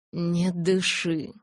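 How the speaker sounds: slow, drawn-out warning; grave, stretched-out caution. A narrator's reading. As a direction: warn her slowly and deliberately. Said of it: "предупредил он".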